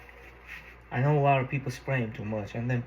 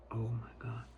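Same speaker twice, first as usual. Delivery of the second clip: whispering; 3 semitones lower, 12 dB quieter than usual. Real, not acted. Tasting the pancakes.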